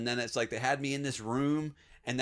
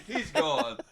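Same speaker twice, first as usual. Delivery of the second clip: retold exasperation; 6 semitones higher, slower than usual. Dad explains to mom, exasperated.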